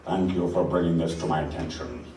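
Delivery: quiet villain. Acted. Villain on the phone.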